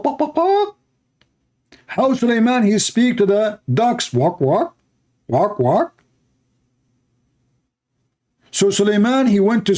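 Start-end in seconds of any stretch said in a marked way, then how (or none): 0.0-0.8 high squeaky hen clucks; high chicken clucking. Retold or imitated. Mock chicken clucking to show how Sulaiman talks to birds. 4.1-6.0 duck quack as words, rising at the end; mock duck quacking. Mock duck call to show how Sulaiman talks to ducks.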